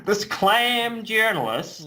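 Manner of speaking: bad American accent, pitched high and slow; hammed-up American drawl on clam; terrible American accent. Shown as a demonstration. Podcaster mocking his own bad American accent. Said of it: "that's my terrible American accent".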